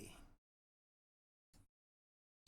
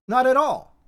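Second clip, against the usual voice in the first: emphatic; 6 semitones higher, 6 dB louder than usual. Real, not acted. Preacher stressing love over family.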